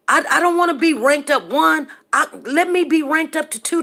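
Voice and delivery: loud, high insistence; insistent. Real, not acted. To her viewers, loud and insistent. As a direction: hammer it home.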